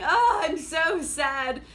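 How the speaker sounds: mock a moping hero's lament; overdone tragic sigh of a sad young man; melodramatic whining. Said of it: "Victor going".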